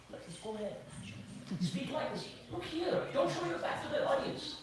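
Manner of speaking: hushed stage prompt from behind the curtain; urgent, soft coaching from offstage; director prompting. Acted. Director cues the actor from behind the curtain, hushed prompting. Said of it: "what does the director do behind the curtain".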